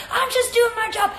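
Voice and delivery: high, exasperated mock protest; Alyssa Nair. Host as goalkeeper protesting to hugging teammates, exasperated. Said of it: "She's like".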